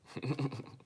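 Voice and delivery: high-pitched chuckle; short burst of high laughter; amused laugh. Real, not acted. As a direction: giggle at what was just read. Laughs at the word buffoon.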